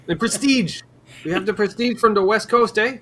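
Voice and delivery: high mock French Canadian clerk; French Canadian accent. Mocking a Canadian store clerk, French Canadian accent. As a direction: play the Quebec store clerk, hammed up. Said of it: "broken French Canadian accents".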